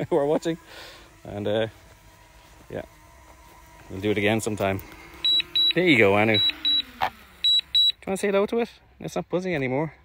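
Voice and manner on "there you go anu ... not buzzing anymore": high, sing-song voice for a pet; talking to a dog. Real, not acted. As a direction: coo at the dog playfully.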